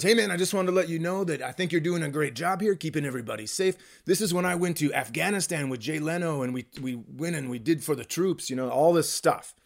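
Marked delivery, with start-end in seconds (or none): 0.0-8.5 fast, softer laid-back buddy voice; an easygoing comic's voice; Willie's voice. Retold or imitated. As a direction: charm the cops like an old friend. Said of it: "I am doing his exact voice".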